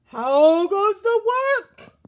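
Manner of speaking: slow, high, loud drawn-out call; calling farmer. A narrator's reading. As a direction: stretch the call out lazily from bed. Farmer calls again.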